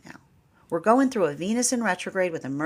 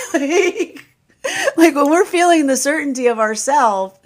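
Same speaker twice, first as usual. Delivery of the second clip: laughing; 9 semitones higher, 9 dB louder than usual. Real, not acted. Laughing through talk.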